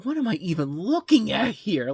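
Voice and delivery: high-pitched incredulous question; mock bewilderment. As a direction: act out baffled disbelief. Acting out bewildered reaction to film.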